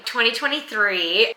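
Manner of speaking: "Twenty twenty-three" repeated in shocked realisation; surprised. Real, not acted. Vlogger catching her own mistake, "Twenty twenty-three" in disbelief. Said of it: "Did I say twenty twenty-three".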